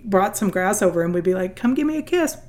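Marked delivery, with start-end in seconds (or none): 1.6-2.4 put-on high, sweet child coaxing. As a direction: coax the cow like a little girl. Daughter coaxing the cows.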